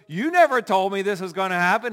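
loud, high indignant complaint; accusing spouse. Retold or imitated. Spouse accusing partner who forgot the plans. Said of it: "and the person goes".

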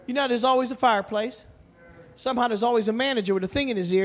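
ordinary talk, nothing marked

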